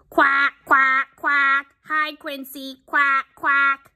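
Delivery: high, loud quacking character voice; shouting duck. Acted. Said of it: "shouts Dottie".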